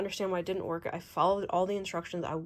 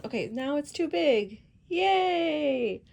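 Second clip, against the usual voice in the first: sarcastic cheer; 7 semitones higher, 5 dB louder, slower than usual. Real, not acted. Cover turns out too big.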